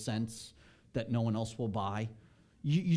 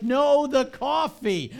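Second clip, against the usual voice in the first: slow, drawn-out, louder swooning reply; coffee-crazed; 12 semitones higher, 10 dB louder, much slower than usual. Retold or imitated. Husband to his wife over the bag, slow and swooning. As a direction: savour every word like the smell of beans.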